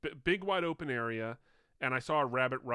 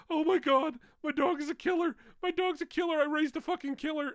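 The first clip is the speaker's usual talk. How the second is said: much higher, louder frantic outburst; panicked; 5 dB louder than usual. Retold or imitated. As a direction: freak out about your killer dog. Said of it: "I was panicked".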